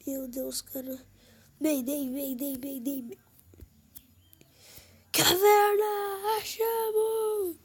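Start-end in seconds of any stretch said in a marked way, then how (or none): 5.1-7.6 high, loud and slow; excited slow shout. Real, not acted. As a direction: stretch it out in triumph. Finds a cave.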